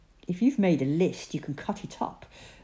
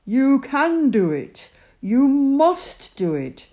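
God's voice; 8 semitones higher, 9 dB louder than usual. Acted. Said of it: "God answered".

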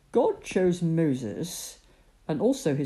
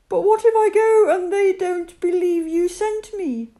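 startled Moses; 14 semitones higher, 10 dB louder than usual. Acted. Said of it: "Moses asked".

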